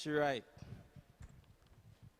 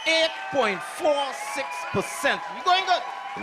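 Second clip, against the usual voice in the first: excited shout; 8 semitones higher, slower than usual. Real, not acted. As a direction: shout the number out like a win. Hosts cheering the rising percentage.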